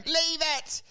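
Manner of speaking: high, whining apology from the ground; hammed-up pitiful excuses; acted pleading. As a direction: beg off an attacker. Beaten man begs on the ground.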